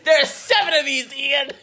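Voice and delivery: high, loud, laughing protest. Real, not acted. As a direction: cackle through the words. Laughing argument over Potter.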